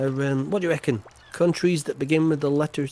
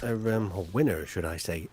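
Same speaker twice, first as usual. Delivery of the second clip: ordinary talk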